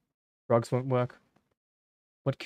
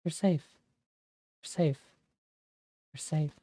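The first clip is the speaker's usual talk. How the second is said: gentle repeats growing softer; soothing, fading; 4 semitones higher, 4 dB quieter than usual. Acted. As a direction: lull him, quieter each time.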